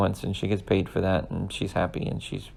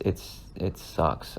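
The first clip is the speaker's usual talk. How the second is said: ordinary talk, nothing marked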